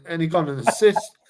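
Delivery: ordinary delivery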